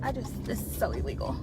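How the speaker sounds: breathy whisper, muttered to herself while driving; whispered aside. Real, not acted. Driver whispering a guilty aside to herself, hushed. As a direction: hush it like you're saying something you shouldn't.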